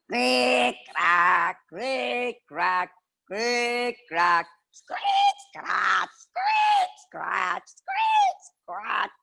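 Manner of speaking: high squeaky creak, crack; creaky rocking chair. A narrator's reading. Narrator mimics the old lady's creaking rocking chair.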